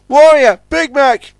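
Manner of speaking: high, loud call. Acted. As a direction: call across the harbour. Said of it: "he called".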